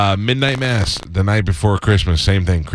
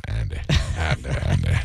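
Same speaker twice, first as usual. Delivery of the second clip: much lower, put-on droning voice; mock stuck voice; 11 semitones lower, 7 dB quieter than usual. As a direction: ham up a garbled voice. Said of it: "all I could say was".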